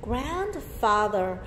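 Grandfather stretched out slowly; teacher repeating Grandfather slowly; slow model word. Shown as a demonstration. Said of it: "thêm chữ grand".

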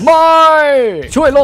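a sudden high, loud no; panicked no. Acted. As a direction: cry out no in alarm. Refusing in panic. (ร้องว่าไม่เสียงดังอย่างตกใจ)

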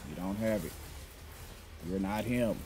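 ordinary delivery

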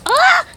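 sudden high, loud shriek; startled scream. Real, not acted. Gamer ambushed by baby zombie, startled scream. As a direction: yelp as it lunges at you.

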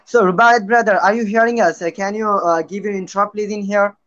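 ordinary talk, nothing marked

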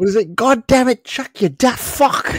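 high, slow hammed-up British accent drifting Irish; mock British accent. Co-host plays British guy cursing Chuck, mock British accent. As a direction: ham up a British curse slowly and high. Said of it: "It sounded more like ... Irish".

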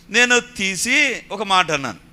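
ordinary talk, nothing marked

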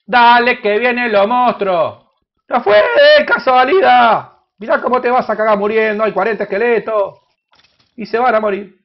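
loud, excited shouting at the monsters; excited yelling. Real, not acted. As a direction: yell it out as the skeletons swarm you. Monsters attack in game.

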